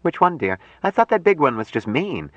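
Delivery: high-pitched woman's voice; puzzled, caring Jill. Acted. Jill asks Mike which monkey he means, puzzled.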